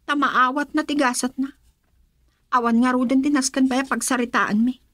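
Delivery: ordinary talk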